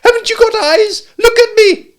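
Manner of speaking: very high, loud squeaky demand; shouting. A narrator's reading. Piglet demanding that Kanga look at him, shouting. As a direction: squeal it out in exasperation. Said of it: "shouted Piglet".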